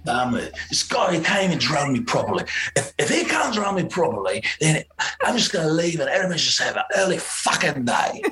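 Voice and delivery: high, hammed-up furious rant; imitating Statham storming off set; mock angry Jason Statham. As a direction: impersonate a star losing his temper mid-take. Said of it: "Jason Statham gets mad".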